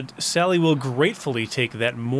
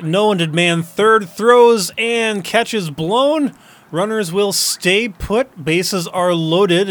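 excited play-by-play call; 5 semitones higher, 5 dB louder, slower than usual. Acted.

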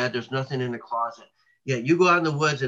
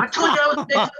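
high, louder mock yell in a put-on woman's voice; retold yelling; 13 semitones higher, 6 dB louder, faster than usual. Wife insists to husband it was Bigfoot, high hammed-up yell. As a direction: shout it like the frantic wife proving her point. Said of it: "she's kinda yelling at her husband".

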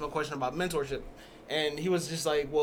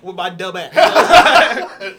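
very slow, loud, laughing; 16 dB louder, much slower than usual. Real, not acted. Teasing herself while thanking friends, laughing. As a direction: stretch it out, cracking up.

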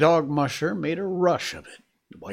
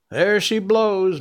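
loud, slow call across the river; cried out; 7 semitones higher, 7 dB louder, slower than usual. A narrator's reading. Matt calling out at the steamboat, loud and slow. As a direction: bellow it out, drawn long. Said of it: "Matt cried".

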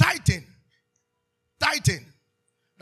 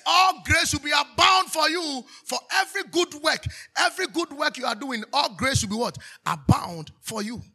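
full-force preaching; 6 semitones higher, 11 dB louder than usual. Real, not acted. Preacher promising abounding grace to the congregation, forceful shout. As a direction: preach it hard to the whole crowd.

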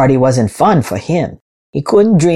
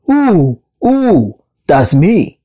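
excited yell; 3 semitones higher, slower than usual. A narrator's reading. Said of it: "yelled Hippo".

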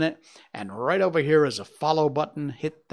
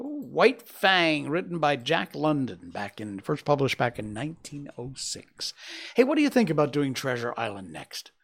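plain reading voice

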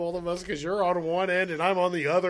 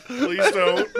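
laughing plea; 9 semitones higher, 7 dB louder than usual. Real, not acted. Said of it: "No, no".

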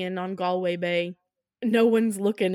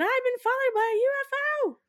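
very high, fast screech; mock panicked wail; faster than usual. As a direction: burst in wailing in comic panic. Fleeing woman's cry, acted.

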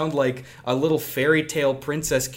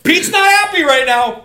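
much higher and louder, mock-solemn; mock announcement; 14 semitones higher, 10 dB louder than usual. Joking to friends about dad's mood, loud mock voice. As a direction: announce it like a dramatic narrator.

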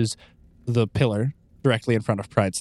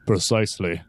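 ordinary talk, nothing marked

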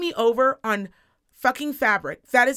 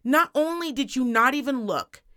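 usual speaking voice

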